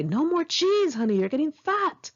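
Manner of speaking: high singsong mocking cook; teasing cook. Cook mocking the waitress for gaining weight, high singsong tease. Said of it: "he would say".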